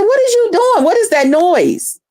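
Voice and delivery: surprised question. Retold or imitated. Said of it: "I said".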